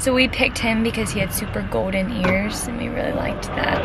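ordinary delivery

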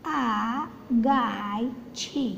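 very slow, word by word; slow classroom reading; slow reading aloud. Shown as a demonstration. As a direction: read it out slowly for beginners. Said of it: "अब छे बराबर छ".

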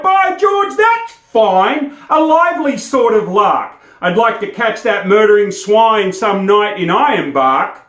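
indignant rant. Acted. Bushman keeps ranting.

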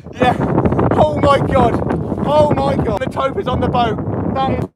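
ordinary talk, nothing marked

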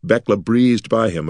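plain reading voice

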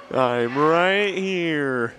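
stretched slow sleepy words; very slow drawl. Pretend. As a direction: drag it out, half asleep. Drawn-out sleepy repeat.

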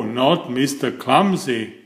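ordinary delivery

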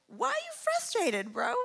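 high-pitched mock challenge; unapologetic. Jesus answering frustrated listeners, unapologetic. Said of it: "He's unapologetic".

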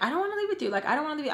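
high-pitched pleading refusal, acted out; retold protest. Acting her refusal to leave.

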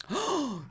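sudden high gasp of surprise; sharp gasp. Real, not acted. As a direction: gasp as the result pops up. Sees the guess was close.

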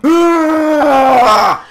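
high, loud, drawn-out "ah"; sustained full-voiced yell; long scream. Outlaw losing it right after calming down, long scream. Meltdown resumes.

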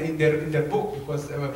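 usual speaking voice

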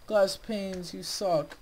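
exasperated jab at the blocks; annoyed. Real, not acted. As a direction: tell the glass off.

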